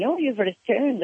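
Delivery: lightly voiced high, drawn-out greeting; a hint of greeters welcoming someone home; imitated welcome. Acts out the locals welcoming her.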